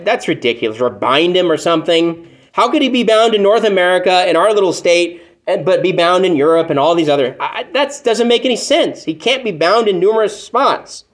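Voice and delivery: ordinary talk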